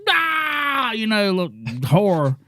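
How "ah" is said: retold victim's scream, high and short; a sudden high yelp imitating the stabbed man; mock scream. Man being stabbed, a high mock cry. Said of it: "he's like".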